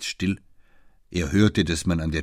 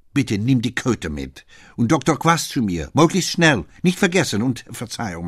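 rising, louder, pushing to hurry her; growing urgency; 5 semitones higher, 5 dB louder than usual. Acted. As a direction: build from a request to a higher, louder urge. (Abba drängt sie hinaus, immer höher und lauter)

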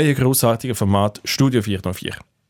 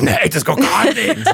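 laughing mock protest, pitched up; mock quote; 7 semitones higher, slower than usual. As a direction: play her saying no with a laugh. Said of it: "Dass du sagst". (gespielt empörtes Nein)

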